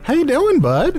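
high, eager greeting; overeager parroting. Acted. Host relearning his own greeting, overeager and high.

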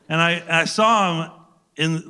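ordinary talk

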